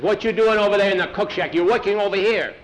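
loud, high, demanding reprimand; scolding. Acted. Pedro catching boys at the cook shack, scolding. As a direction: tell them off from across the yard.

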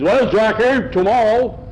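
high, loud farmer voice; gruff farmer voice. Acted. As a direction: bark it like a rough farmer. Farmer talks at table.

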